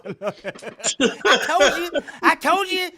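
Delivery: high, loud puppet taunting; a high puppet crowing over the arrest; gloating puppet. Retold or imitated.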